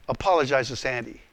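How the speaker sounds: low, unmoved command; acted voice of God, firm and low; God's voice. God's unmoved reply in acted argument.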